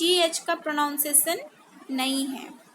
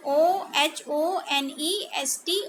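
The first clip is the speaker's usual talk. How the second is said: ordinary delivery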